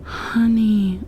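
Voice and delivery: normal talking voice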